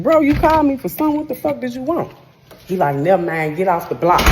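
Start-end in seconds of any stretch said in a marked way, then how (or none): 0.0-2.1 much higher, louder, fast confrontation; angry shout. Retold or imitated. Confronting the guy on the block. 2.9-4.2 louder, curt command; gruff order. Retold or imitated. Guy ordering her away.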